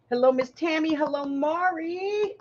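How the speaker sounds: high, slow drawn hello; sing-song greeting. Real, not acted. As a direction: stretch each hello out.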